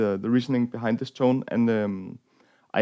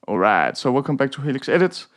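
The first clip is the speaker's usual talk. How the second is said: ordinary talk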